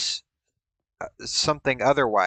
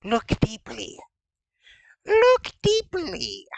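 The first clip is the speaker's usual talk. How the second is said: high, slow mystic seer; breathy psychic medium voice; fortune teller voice; slower than usual. Pretend. Mystic seer voice, crystal ball.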